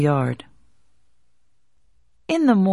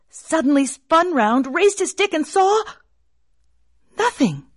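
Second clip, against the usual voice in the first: sudden burst; 10 semitones higher than usual. A narrator's reading. Sudden turn, climax.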